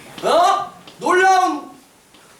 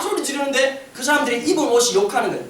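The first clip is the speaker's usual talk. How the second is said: usual speaking voice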